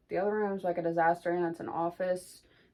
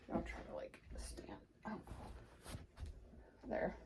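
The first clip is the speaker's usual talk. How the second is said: hushed whisper while moving; 4 semitones higher, 17 dB quieter than usual. Real, not acted. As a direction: breathe the words, no voice. Stepping back to film bump.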